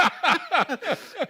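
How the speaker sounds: high loud burst of laughter; real laughing fit; big laugh. Real, not acted. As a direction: crack up hard. Big laugh after story.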